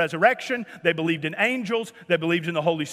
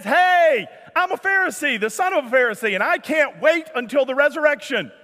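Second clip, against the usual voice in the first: high, raised voice hailing a crowd; rousing call-out; 5 semitones higher, 3 dB louder than usual. Acted. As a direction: call out provocatively to a divided room. Paul calls out to provoke the council.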